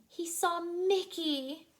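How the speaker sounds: usual speaking voice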